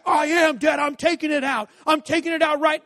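high, loud insisting shout; defiant teen yell. Retold or imitated. Son shouting at dad that he's doing it, high and loud. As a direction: yell back that you're on it.

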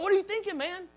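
high, incredulous protest; comic disbelief at the coach; half-laughing indignation; mock outrage. Outraged at the trainer.

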